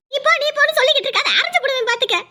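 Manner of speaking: quick, high-pitched scolding outburst; angry threat. Acted. Mother threatening to slap her dismissive daughter, angry. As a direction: snap back fast and threaten her.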